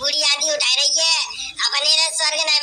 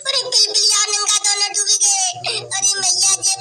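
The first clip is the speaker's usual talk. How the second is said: mock wailing lament; 4 semitones higher than usual. Wailing for drowned pet.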